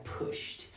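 "pushed" as pusht, one syllable; "pushed" ending in sh plus a crisp t. Shown as a demonstration. Teacher repeating the model for learners, "pushed" said pusht. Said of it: "se pronuncia pushed".

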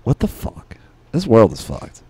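usual speaking voice

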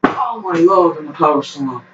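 high-pitched play-by-play with stretched exclamations; excited wrestling commentary. Pretend. Backyard wrestler calling his own match, excited play-by-play. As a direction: call the moves as they land, pitch climbing with the action.

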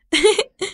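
short high chuckle; light laugh. Acted. Father laughs as the cat runs away, light chuckle. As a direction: laugh it off gently. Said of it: "dijo riéndose".

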